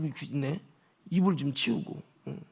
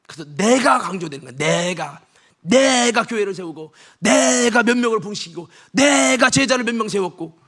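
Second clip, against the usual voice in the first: normal talking voice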